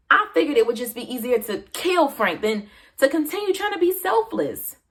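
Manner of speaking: acted breaking point, pitch climbing; mounting exasperation. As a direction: build from strained patience to outburst. Character recounts reaching breaking point.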